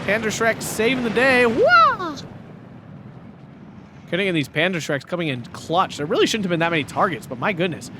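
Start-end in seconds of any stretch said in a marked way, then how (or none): 1.4-2.2 sudden high excited yelp. Real, not acted. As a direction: let out a startled wah. Excited caster cries out.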